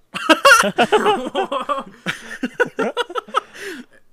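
loud high howling laugh; full roar of laughter; burst of laughter. Real, not acted. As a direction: crack up loudly and high. Laughing at own joke.

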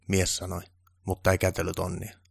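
plain reading voice

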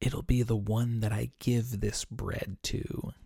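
a soft, hushed answer, not quite a whisper; whispered reply. Acted. Jesus answering John at the table, softly hushed. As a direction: lean in and answer under the breath. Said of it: "Jesus whispers back".

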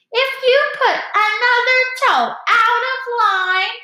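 very high, loud mocking screech; shrill, piercing voice. A narrator's reading. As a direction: screech it shrilly, imitating a scolding mother. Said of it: "put on a shrill, piercing voice".

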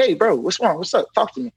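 high, gentle coaxing voice; concerned coaxing. Retold or imitated. Officer coaxing a crying inmate to talk, high and gentle. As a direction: coax a sad friend to open up. Asking upset inmate what's wrong.

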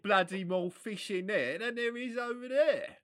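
high, loud mock London fishmonger accent. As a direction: put on an exaggerated London market porter's accent. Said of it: "I find the accent really hilarious".

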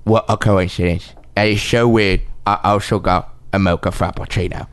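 a hint of Cockney, low and measured; lightly voiced English film star; Michael Caine voice. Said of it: "your Michael Caine".

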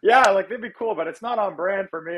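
high mock chatter; imitated loud talker. Friend chatting over a serious interview, mock loud. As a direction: pitch it up, oblivious and too loud at first. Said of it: "he just talks so loud".

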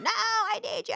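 high-pitched imitation of a needy kid; clingy small child. Mom mimics her three and a half year old, clingy.

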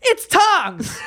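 high-pitched comic outburst; mock exasperated shout. Mock outburst at being called rich.

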